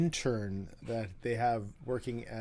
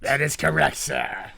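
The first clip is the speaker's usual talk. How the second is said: pirate voice; 3 semitones higher, 8 dB louder than usual. Pretend. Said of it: "Why do you always do the pirate voice".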